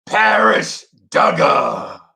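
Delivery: high, slow announcer-style call of the name; stretched name call. Real, not acted. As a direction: announce him like he walked in. Greeting a viewer in chat.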